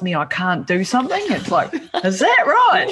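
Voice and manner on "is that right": high-pitched mock challenge with a laugh; rebellious challenge. Rebel answering anyone who says she can't, challenging. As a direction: throw it back like a dare. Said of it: "that's a rebellious nature that comes out".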